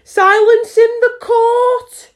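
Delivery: high, loud, slow official cry; cried out. Acted. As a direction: call the court to order at full volume.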